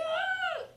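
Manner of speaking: loud, high-pitched shriek; short acted horror-movie scream; babysitter's scream. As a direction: shriek in sudden terror.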